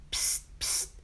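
short unvoiced "psst, psst"; hissed psst. A narrator's reading. Gnome secretly calls Wiebelstaartje from the mousehole, hissed psst. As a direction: hiss softly to get attention.

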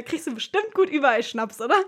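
loud, teasing imitation of the sweep; joking sweep voice. Teen mimics the sweep's cheeky Schnaps remark, loud and teasing. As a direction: toss off his cheeky remark, loud and amused. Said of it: "Und dann meinte er erstmal".

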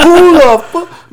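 cracking up in shocked disbelief; laughing disbelief. Real, not acted.

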